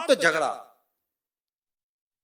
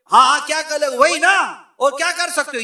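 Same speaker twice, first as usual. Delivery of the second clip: higher, louder scornful taunt; mocking taunt; 4 semitones higher, 5 dB louder than usual. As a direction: egg him on with scorn. Wife mocks husband loudly.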